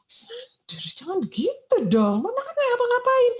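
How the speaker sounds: high, loud pleading scold; mother hushing her son in front of the bus, high and flustered; flustered mom. Retold or imitated.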